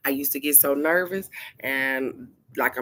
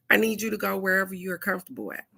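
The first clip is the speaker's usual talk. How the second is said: fast; 3 semitones higher, much faster than usual. Retold or imitated. Repeating demand rapidly.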